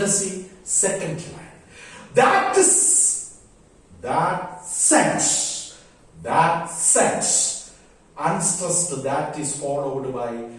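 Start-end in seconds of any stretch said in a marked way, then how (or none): 4.0-5.8 two-beat demo stressing "sense". Shown as a demonstration. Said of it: "sense, stressed". 6.2-7.8 two-beat demo stressing "sense". Shown as a demonstration. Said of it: "sense, stressed".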